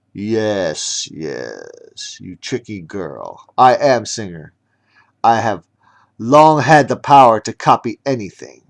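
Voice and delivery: put-on gloating young villain; loud, taunting villain reveal. Villain Singer speaks.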